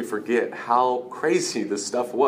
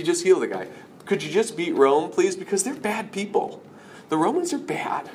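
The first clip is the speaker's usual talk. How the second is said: worked-up, higher-pitched pleading objection; protesting Peter; 5 semitones higher than usual. Acted. Peter telling Jesus to beat Rome, acted pleading protest. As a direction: argue back, climbing higher as you plead. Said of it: "Peter says".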